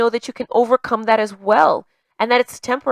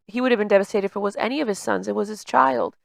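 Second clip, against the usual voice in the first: ordinary talk, nothing marked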